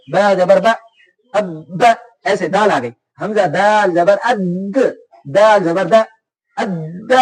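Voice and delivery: अब and अद spelled slowly with the doubled consonant squeezed and held; pressed shadda. Shown as a demonstration. Teacher spelling out letters for a child, clamping the doubled letter. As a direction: lean on the doubled द in अद, slow and firm. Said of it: "थोड़ा इस पर जोर दें कि शद वाला लफ्ज आके यूं थोड़ा यूं तो हिले".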